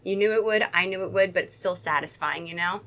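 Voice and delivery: usual speaking voice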